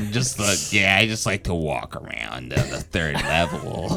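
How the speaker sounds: low, soft creepy murmur; creepy loner. Pretend. Lurker in a parking garage, mock creepy. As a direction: keep it deep and hushed, joking menace.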